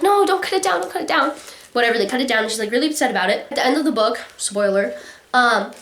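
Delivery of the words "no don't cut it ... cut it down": put-on pleading girl.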